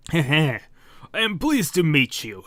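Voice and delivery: chuckle, then greet him theatrically; villainous monster king. Acted.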